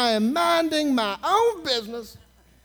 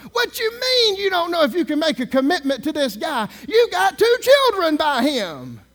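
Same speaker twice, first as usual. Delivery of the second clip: louder, higher scolding retort; indignant retort; 6 semitones higher, 6 dB louder than usual. Retold or imitated. Preacher scolding the teller, raised indignant voice. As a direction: scold her for doubting the father.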